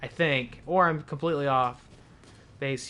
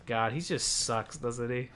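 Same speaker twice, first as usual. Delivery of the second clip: ordinary talk, nothing marked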